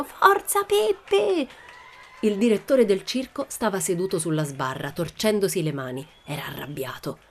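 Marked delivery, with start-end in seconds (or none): 0.0-1.5 very high, stretched-out cheer; long, high-pitched rooting call; crowd's cheering shout. A narrator's reading. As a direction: cry it out like a whole audience. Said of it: "gli altri spettatori gridarono".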